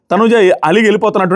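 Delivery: normal talking voice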